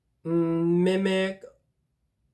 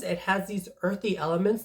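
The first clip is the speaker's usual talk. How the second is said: usual speaking voice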